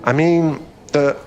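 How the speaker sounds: panicked backpedal, pitch jumping up; flustered backtracking. Acted. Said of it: "I'm so embarrassed".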